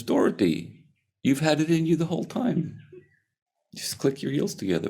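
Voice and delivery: high, lilting fairy-godmother voice; Glinda the Good Witch. Acted. Good witch revealing the secret to Dorothy, high and lilting. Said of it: "Glinda the Good Witch comes and says".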